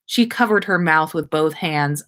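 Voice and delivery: usual speaking voice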